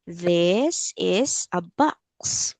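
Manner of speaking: very slow, word by word for a learner; teacher's slow repeat-after-me delivery; slow model sentence. Shown as a demonstration. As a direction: draw out each word for repeating. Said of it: "So let's say".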